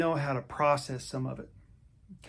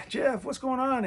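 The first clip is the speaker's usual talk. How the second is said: cheery greeting; 11 semitones higher than usual. Retold or imitated. Doctor's cheerful greeting voiced.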